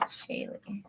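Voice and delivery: breathy whisper to herself; whispered name. Real, not acted. Tarot reader whispers client's name while shuffling. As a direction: murmur the name as you shuffle.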